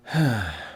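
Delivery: short breathy sigh. Acted. Hotel owner after refusing to sit closer, sighing. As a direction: let out a brief, audible sigh. Polly turns down moving closer.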